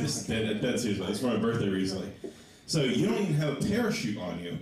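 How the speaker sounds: normal talking voice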